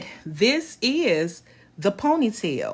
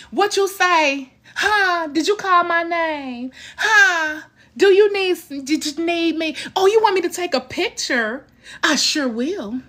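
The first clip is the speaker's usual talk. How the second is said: sweet high call; 8 semitones higher than usual. Real, not acted. Answering someone off camera who called her name, high and eager. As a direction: answer someone across the room, eager and playful.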